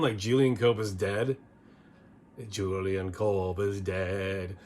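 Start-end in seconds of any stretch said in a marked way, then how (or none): 2.5-4.6 slow, drawn-out mock Scottish crooning; thick Scottish accent. Host imitating a goofy song lyric, mock Scottish accent. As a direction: stretch it out in a bad Scottish singing voice. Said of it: "with a thick Scottish accent singing".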